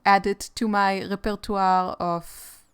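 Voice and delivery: French word in a heavy Israeli accent; slow, Israeli-accented French. Real, not acted. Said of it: "That was saying a French word in an Israeli accent".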